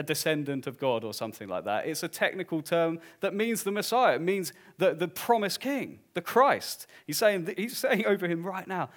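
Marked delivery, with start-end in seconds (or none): none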